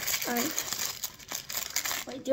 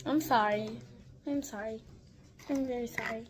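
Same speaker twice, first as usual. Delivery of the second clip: much softer, lower sheepish sorry; soft apology; 6 semitones lower, 11 dB quieter than usual. Pretend. Apologising to the doll, softly and sheepishly. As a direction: murmur it to the doll, embarrassed.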